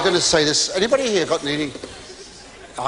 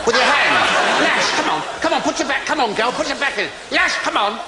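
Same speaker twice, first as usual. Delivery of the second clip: higher, louder coaxing, mock coach cheering; urging on; 8 semitones higher, 3 dB louder than usual. Husband urging his bride to splash the bath, hammed-up cheering. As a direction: egg her on to lash the waves.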